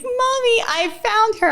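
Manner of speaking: put-on high little-girl voice calling to mommy; child proudly reporting to her mom. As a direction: squeal it like a small child. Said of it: "then she was like".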